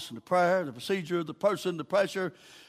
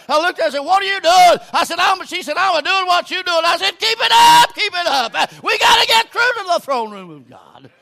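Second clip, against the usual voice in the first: loud, high shouted storytelling; full-force preaching; 13 semitones higher, 15 dB louder than usual. Real, not acted. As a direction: holler the exchange at revival pitch.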